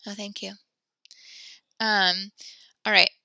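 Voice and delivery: ordinary talk, nothing marked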